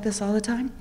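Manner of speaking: a soft, even social work voice. Shown as a demonstration. Social worker demonstrating her calm voice to the panel, soothing. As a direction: show the soothing voice you use with kids. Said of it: "my son calls this voice my social work voice".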